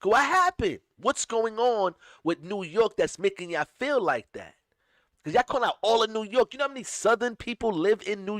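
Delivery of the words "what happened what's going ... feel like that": high, loud plea; a raised, pleading question. Retold or imitated. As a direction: ask it with hurt disbelief. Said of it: "I'm saying".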